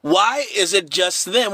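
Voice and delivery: ordinary delivery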